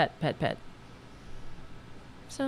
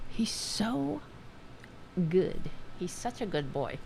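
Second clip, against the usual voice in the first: cooing to cat; 9 dB quieter than usual. Real, not acted. Host cooing softly to her cat, tender. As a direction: talk gently to a pet.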